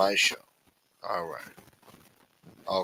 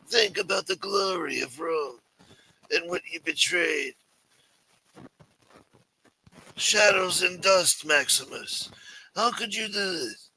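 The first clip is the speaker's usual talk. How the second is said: higher, grandiose disappointed mafioso; Chris Colombo impression; 5 semitones higher than usual. Imitating Chris Colombo guilting Mike about his father and Rome, in a higher voice. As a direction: guilt him with old-world pride. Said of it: "You do a good Chris Colombo?".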